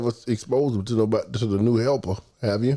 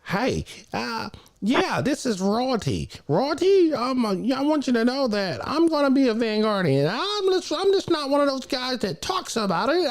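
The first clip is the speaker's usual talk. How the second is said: Royalty's voice. Retold or imitated. Imitating Royalty introducing himself on the phone, high put-on voice. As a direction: squeeze the voice up high like the caller. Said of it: "Let me get his voice".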